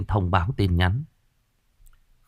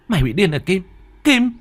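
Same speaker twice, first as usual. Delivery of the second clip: high frantic cry at Kim; shocked shout at a friend; 1 alarmed shout; 10 semitones higher, 3 dB louder than usual. Acted. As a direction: yell her name in panic. Hương shouts at Kim after hanging.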